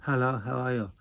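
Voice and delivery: imitating a Chinese accent; lightly put-on Chinese accent, kept quiet; mock Chinese accent. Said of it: "narrow your eyes and go".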